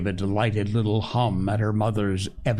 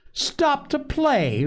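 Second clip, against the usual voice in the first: high, drawn-out indignant echo; much slower than usual. A narrator's reading. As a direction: fling the question back, offended, pitched way up. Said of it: "reported Joe indignantly".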